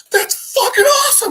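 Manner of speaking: high, loud fanboy squeal; hammed-up excited yell; thrilled outburst. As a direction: burst out with uncontained delight. Hero thrilled by gorilla, true fashion.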